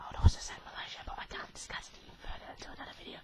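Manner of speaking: hushed, voiceless aside; secretive whisper. Real, not acted. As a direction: whisper it like a secret you can't share. Confidential aside about Malaysia.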